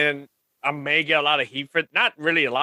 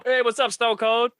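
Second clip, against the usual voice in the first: coworker greeting; 10 semitones higher than usual. Retold or imitated. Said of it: "they literally come up".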